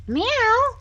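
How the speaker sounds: very high playful meow; mock meow. Real, not acted. Meowing at the cat.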